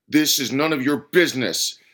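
forceful, confrontational snarl. A narrator's reading. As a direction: warn the outsider off angrily. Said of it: "he snarled".

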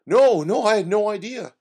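high, loud and rattled; flustered denial. Acted. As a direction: blurt it out in a panic. Oscar flustered before the officer.

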